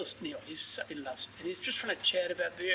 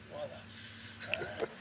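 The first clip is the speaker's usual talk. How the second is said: slow, breathy, disbelieving whisper; stunned whisper; 7 dB quieter, much slower than usual. Retold or imitated. Drinkers stunned by fresh local beer, whispering in disbelief. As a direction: let it out under your breath in shock.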